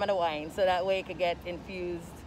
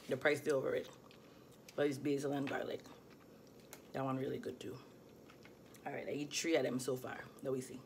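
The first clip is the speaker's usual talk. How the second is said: ordinary delivery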